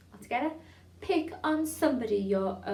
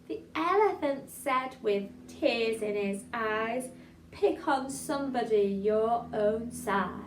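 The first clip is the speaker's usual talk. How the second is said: slow, careful recital; a bit slower; slower than usual. Shown as a demonstration. Teacher repeating the poem for the class, slower. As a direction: take it slowly, line by line. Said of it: "Do it one more time, a bit slower".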